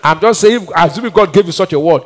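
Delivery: ordinary delivery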